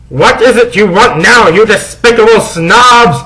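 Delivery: high, loud irritated snarl; angry headmaster. Acted. Dumbledore angrily snapping at the goths. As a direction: sneer at the kids. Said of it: "he asked angrily".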